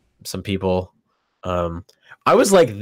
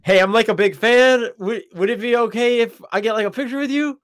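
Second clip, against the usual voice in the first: high, timid fan asking for a photo; high, hopeful fan voice asking Swery for a selfie; 11 semitones higher than usual. Retold or imitated. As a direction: squeak it out like a nervous fan. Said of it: "just be like".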